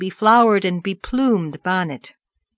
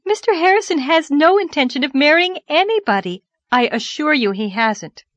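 higher, louder earnest assurance; emphatic denial; 8 semitones higher, 5 dB louder than usual. Acted. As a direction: insist on it, flustered. Flustered denial of marriage rumour.

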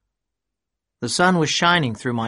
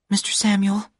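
woman's voice; 10 semitones higher than usual. A narrator's reading. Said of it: "she said".

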